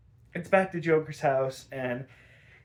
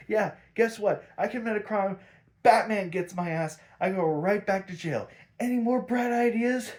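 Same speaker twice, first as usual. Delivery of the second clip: retold sarcastic anger; 6 semitones higher than usual. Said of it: "he is pissed beyond all reason".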